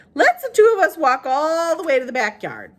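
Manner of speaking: a hint of elderly Southern woman; old woman Gloria. A narrator's reading. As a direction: ask it like a kindly old lady. Gloria voiced by narrator.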